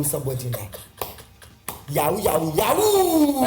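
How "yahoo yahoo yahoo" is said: mocking cry. Mocking the police label.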